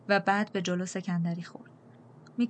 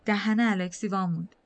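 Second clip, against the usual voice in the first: ordinary delivery, nothing marked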